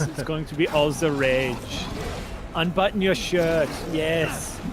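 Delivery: put-on slow, generic European accent, a bit German; Euro trash accent. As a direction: ham up a vague Eurotrash European accent, slowly. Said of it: "Euro trash".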